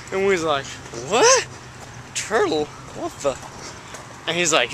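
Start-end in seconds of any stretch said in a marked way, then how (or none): 1.1-3.3 high-pitched startled what, then quieter; mock bewilderment. Canoeists meeting a talking turtle, mock bewilderment. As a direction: act baffled, voice jumping up.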